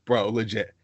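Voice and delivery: usual speaking voice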